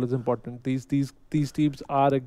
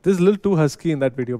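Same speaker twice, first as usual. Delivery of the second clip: ordinary talk, nothing marked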